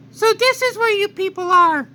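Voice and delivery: put-on very high, odd childlike monster voice; Majin Buu. Buu greeting the people on the lookout, high and odd. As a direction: Buu speaking simply, high and strange.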